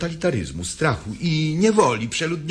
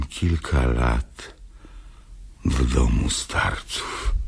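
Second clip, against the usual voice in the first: put-on old woman; 13 semitones lower than usual. Old woman introduces herself.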